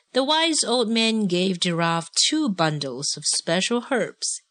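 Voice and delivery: usual speaking voice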